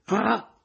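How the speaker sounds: sudden loud high ah; anguished cry. A narrator's reading. The narrator cries out remembering the kiss.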